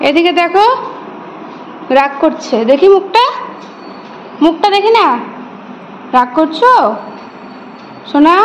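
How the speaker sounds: pleading high sing-song to a pouting little one. Real, not acted. Mother coaxes a sulking child.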